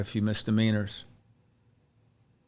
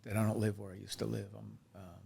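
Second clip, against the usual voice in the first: tearing up; 10 dB quieter than usual. Real, not acted. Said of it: "I feel like I'm tearing up".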